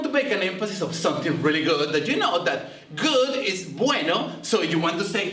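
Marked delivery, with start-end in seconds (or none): none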